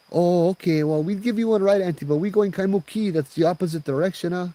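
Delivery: high, awkward polite excuse. Acted. Driver explaining to auntie they go elsewhere, high and apologetic.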